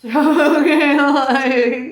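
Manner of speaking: bursting into laughter mid-word; laughing. Real, not acted. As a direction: laugh it out loud. Joking about getting a hearse.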